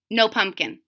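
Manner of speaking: plain reading voice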